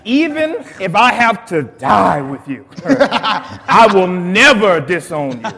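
reading through laughter, slow; laughing while reading. Real, not acted. Pastor laughing as he reads Peter's promise, slowly. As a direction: chuckle through the boast.

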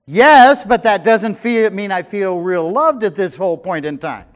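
wife explaining she doesn't feel loved, loud and high; wife's loud reply. Retold or imitated. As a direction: answer with a loud, high protest. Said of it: "she would push me away and say".

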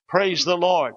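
normal talking voice

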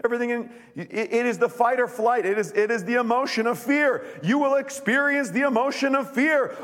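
high, loud fervent preaching; rally the room; preaching at full force. Real, not acted. Pastor rallying the church to take courage, fervent.

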